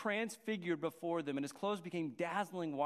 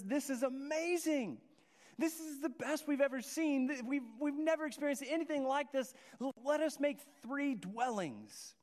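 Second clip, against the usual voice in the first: awed excitement; 7 semitones higher than usual. Acted. Peter voiced in awe.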